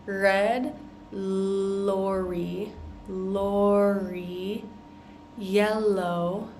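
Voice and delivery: Red lorry drawn out, with a clear back R and a front L; slow, stretched R and L. Shown as a demonstration. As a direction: stretch each sound of Red lorry very slowly. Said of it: "My tongue is back here and up here".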